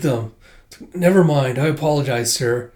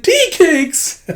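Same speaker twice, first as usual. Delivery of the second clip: put-on very high, loud, giggling kid squeal; thrilled squeal; 5 dB louder than usual. Little girl spotting the tea cakes, very high giggling squeal. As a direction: squeal it with a laugh. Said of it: "And she's like".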